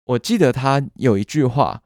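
ordinary delivery

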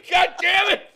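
hammed-up shout of frustration; mock rant. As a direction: bark it at a bad actor like a fed-up director.

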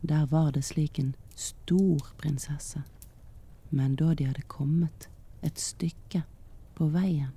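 ordinary delivery

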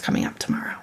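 soft whispered ending. Retold or imitated. Mom to her teen, ending the refusal in a whisper. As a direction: let it fade to a whisper.

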